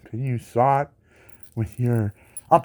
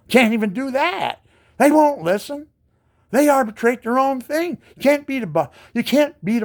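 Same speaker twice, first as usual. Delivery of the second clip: indignant; 12 semitones higher, 4 dB louder than usual. Real, not acted. Angry rant at company.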